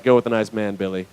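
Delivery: low, soft surrender; resigned parent. Retold or imitated. Parent hands child over to huge man, quietly resigned. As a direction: give in meekly, dropping away at the end.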